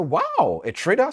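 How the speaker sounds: high, loud amazed question; mock astonishment, voice jumping up; impressed surprise. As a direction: imitate an impressed stranger reacting. Millionaire impressed by the trade.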